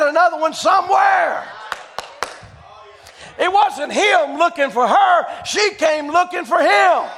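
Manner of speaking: loud, high-pitched sermon delivery; full-force preaching. Real, not acted. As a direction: preach it out to the whole congregation.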